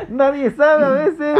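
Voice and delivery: entre carcajadas, más agudo; suéltalo riéndote; riendo. Real, not acted. (diciéndolo entre risas)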